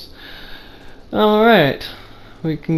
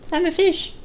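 squeaky fish voice; 14 semitones higher than usual. Pretend. Pretending to be a fish.

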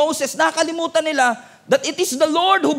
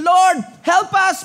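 pleading cry; 7 semitones higher, 6 dB louder, slower than usual. Acted. Israelites crying out to God.

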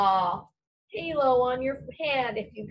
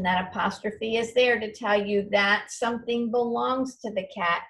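usual speaking voice

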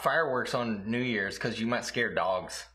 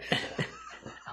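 airy burst of laughter; a quick silent wheeze of a laugh; breathy high laugh; 6 dB quieter than usual. Real, not acted. Laughs at mix-up, unvoiced.

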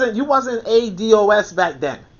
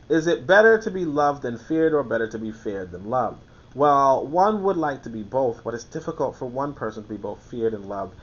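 measured, lower reading voice; reading aloud; 6 semitones lower, 5 dB quieter than usual. Acted. Reading The Prince aloud to his audience, measured tone. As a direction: read the passage out steadily. Reads Machiavelli aloud to listeners.

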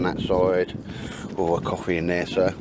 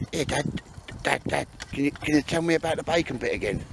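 pestering retold plea, higher voice; imitating Baldrick; 5 semitones higher than usual. Speaker mimics Baldrick on his lap asking Dad, imitating pleading. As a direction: lightly mimic an eager pleader, pitched up. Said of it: "jumping on my lap and going".

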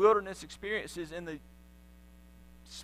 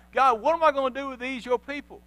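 higher, louder plea of frustration; Moses crying out; 6 semitones higher, 4 dB louder than usual. Acted. Moses crying out to God about the people, frustrated plea. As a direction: throw up your hands at God. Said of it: "Moses cries out to God".

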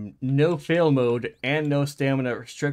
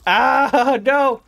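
desperate cry; 9 semitones higher, 12 dB louder than usual. Real, not acted. Attacked, despairs.

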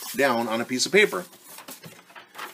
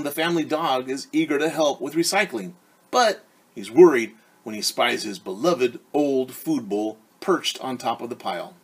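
ordinary delivery

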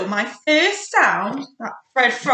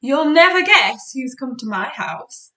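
surprised; 5 semitones higher, 6 dB louder than usual. Acted. Teacher reveals puppet surprise.

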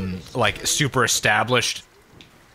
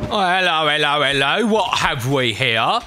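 loose, uncertain Cockney accent; attempted Cockney. Shown as a demonstration. Actor trying to recall Cockney, rough English accent. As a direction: have a go at Cockney. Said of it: "I can't remember how to do a Cockney".

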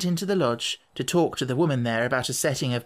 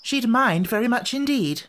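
short reply; 9 semitones higher than usual. A narrator's reading. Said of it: "said Anthea shortly".

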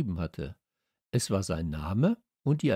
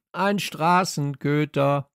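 loud, higher, drawn-out cry; calling out; 6 semitones higher, 6 dB louder, slower than usual. A narrator's reading. Dog answers his puzzled owner, shouting slowly. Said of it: "rief ich".

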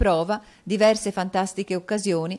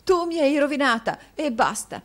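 sobbing reproach; 8 semitones higher, 5 dB louder than usual. A narrator's reading. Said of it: "rispose la moglie singhiozzando".

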